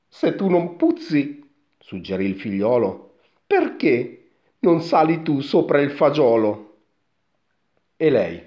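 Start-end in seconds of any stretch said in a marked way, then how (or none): 0.1-1.5 put-on high, loud boy's retort. A narrator's reading. Son challenges his mother, high boy voice. 3.4-6.8 put-on raised, loud boyish challenge; boy's voice. A narrator's reading. Son suggests mother climb instead, raised boy voice.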